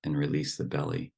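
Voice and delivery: unhurried meditation guidance with long silences; guided meditation. Acted. Teacher settles the group into relaxed breathing, guided meditation voice. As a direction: ease them into stillness, phrase by phrase.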